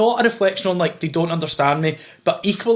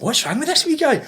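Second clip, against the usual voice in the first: mock puzzled agent; 8 semitones higher than usual. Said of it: "she must have thought".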